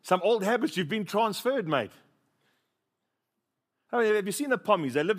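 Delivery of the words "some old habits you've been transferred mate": lightly voiced Aussie, raised pitch; a light Aussie jab at a mate; mock Aussie. Preacher teasing his mate George in a mock Aussie accent. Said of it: "I said".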